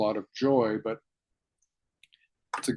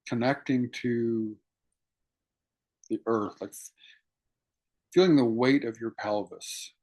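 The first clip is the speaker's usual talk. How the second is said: guided meditation. Acted. Teacher guiding students to feel their sit bones, calm meditation voice. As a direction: ease listeners into their seated bodies, unhurried.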